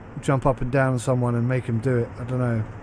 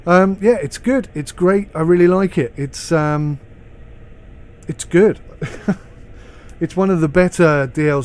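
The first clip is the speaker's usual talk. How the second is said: enthusiastic; 3 semitones higher, 4 dB louder than usual. Real, not acted. Enthusing about the DLC.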